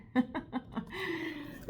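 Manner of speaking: high, airy laugh; a delighted giggle. Real, not acted. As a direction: laugh at your own bit. Laughing after the quote.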